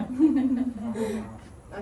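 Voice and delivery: a short trailing chuckle. Real, not acted. Speaker wrapping up her story to the audience, nervous chuckle. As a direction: let the nervous laugh fade out.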